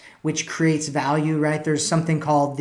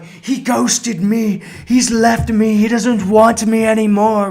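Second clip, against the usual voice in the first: high, loud, hammed-up panic; mock losing it; 6 semitones higher, 6 dB louder, slower than usual. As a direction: imitate someone melodramatically falling apart. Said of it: "and they, like, lose it".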